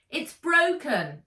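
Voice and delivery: high, loud, slow shout over the storm. Acted. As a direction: yell it up through the hatch. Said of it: "shouted Saskia".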